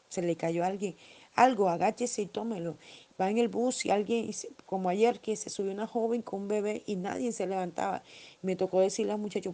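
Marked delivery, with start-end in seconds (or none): none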